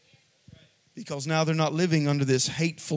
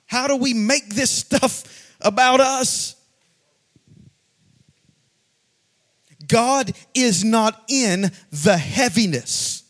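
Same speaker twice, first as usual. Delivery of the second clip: louder, slow preaching with pitch raised; forceful preaching; 5 semitones higher, 3 dB louder, slower than usual. Acted. Pastor urging the congregation, loud slow preaching. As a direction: drive each line home.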